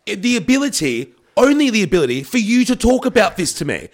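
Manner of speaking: high-pitched, spluttering indignation; worked-up rant. Retold or imitated. Host complaining about being forced to learn films, worked-up rant. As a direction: protest in a high, exasperated comic rant. Comic rant building at movie explainers.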